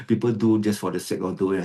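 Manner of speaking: ordinary delivery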